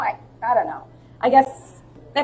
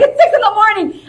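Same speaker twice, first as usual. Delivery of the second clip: high, loud mock protest; exasperated friend; 11 semitones higher, 10 dB louder than usual. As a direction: holler like a friend pestered at dawn. Imitating friend woken at six.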